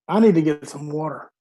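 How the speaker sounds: ordinary delivery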